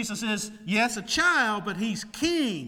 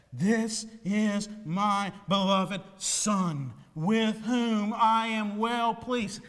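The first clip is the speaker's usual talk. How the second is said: slow solemn voice; slower than usual. Acted. Voice of God from cloud.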